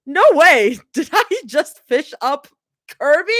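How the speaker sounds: excited shout. Acted. Fishes up Kirby.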